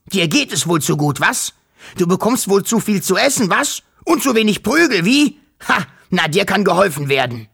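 a furious showman winding himself up to hit; ranting, climbing fury. A narrator's reading. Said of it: "schrie er plötzlich". (sich steigernde Wut, immer höher und lauter)

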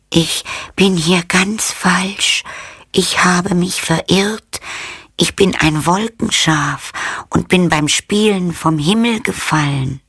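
high, delicate little lamb voice; fine little voice. A narrator's reading. Lost cloud lamb telling the girl, high and delicate. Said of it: "mit feiner Stimme".

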